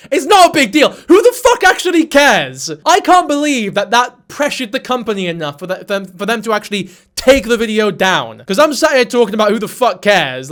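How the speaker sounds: loud, high, fed-up ranting; exasperated rant. Real, not acted. Venting at outraged fans, loud and exasperated. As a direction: blow up at people who care.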